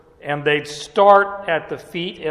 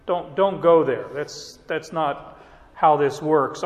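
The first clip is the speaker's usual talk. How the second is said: normal talking voice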